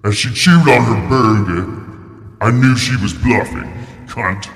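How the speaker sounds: high-pitched theatrical narration; dramatic story reading. Acted. Performing a short horror story, high and theatrical.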